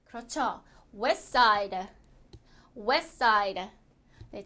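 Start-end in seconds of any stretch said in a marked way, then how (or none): 1.0-1.8 "West side" as "wes-side", no T; T-dropped pronunciation. Shown as a demonstration. Teacher answers how it is pronounced, T dropped. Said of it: "T가 탈락이 돼서". 2.8-3.7 "West side" as "wes-side", no T; T-dropped pronunciation. Shown as a demonstration. Teacher answers how it is pronounced, T dropped. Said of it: "T가 탈락이 돼서".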